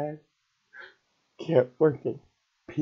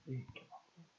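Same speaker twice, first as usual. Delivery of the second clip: "Didn't give up on him" almost breathed away, much softer; near-silent repeat; 21 dB quieter, much faster than usual. Real, not acted. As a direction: let the repeat fade to nearly nothing. Repeats the phrase, second dies away.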